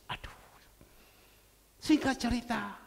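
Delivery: usual speaking voice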